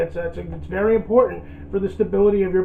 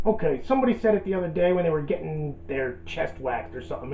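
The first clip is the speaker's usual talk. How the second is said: usual speaking voice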